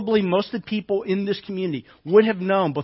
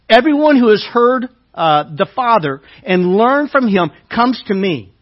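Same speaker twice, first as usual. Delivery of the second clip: loud, measured preaching read; scripture reading; 6 dB louder than usual. Acted. Preacher reading Jesus' words to the congregation, proclaiming.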